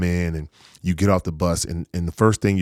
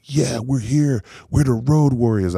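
higher-pitched mock fan cheer; hyped fans; 6 semitones higher than usual. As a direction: imitate fans proudly announcing themselves. Voicing hyped travelling fans.

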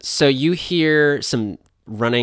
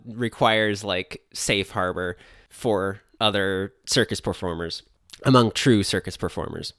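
normal talking voice

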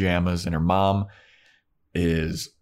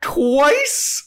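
very high, louder disbelieving Twice; shocked Buffy; 6 dB louder than usual. Retold or imitated. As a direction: daughter aghast at the number. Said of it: "and Buffy goes".

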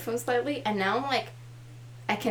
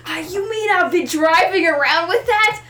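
mock alarm; 5 semitones higher, 5 dB louder than usual. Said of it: "acted thief reaction".

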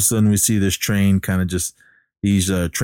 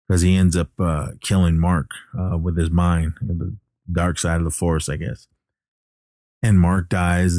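ordinary delivery

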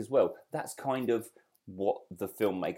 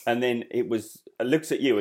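normal talking voice

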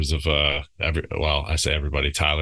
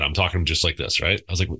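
ordinary talk, nothing marked

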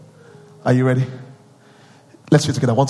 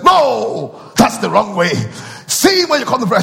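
ordinary talk

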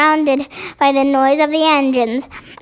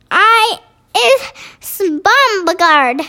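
high, drawn-out mock screech; giant's screech; 5 semitones higher, slower than usual. A narrator's reading. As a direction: screech it slowly, starting high, for a storybook giant. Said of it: "screeched the butcher boy".

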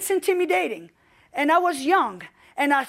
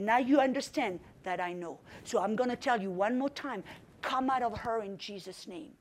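calm quiet command; 5 semitones lower, 10 dB quieter than usual. Retold or imitated.

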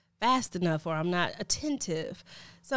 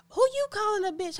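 indignant retort; 14 semitones higher, 4 dB louder than usual. Retold or imitated. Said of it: "somebody's like".

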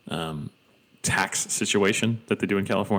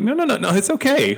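much higher and louder, quick reassurance; high reassuring; 14 semitones higher, 5 dB louder than usual. Retold or imitated. Reassuring an embarrassed newcomer, high and eager. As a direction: soothe them, pitch way up. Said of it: "and I'm like".

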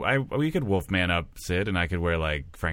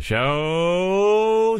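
very drawn out, climbing high; announcer build-up; 11 semitones higher, 5 dB louder than usual. Pretend. Performer introducing a castmate, hugely stretched build-up. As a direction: stretch the last word into a rising fanfare.

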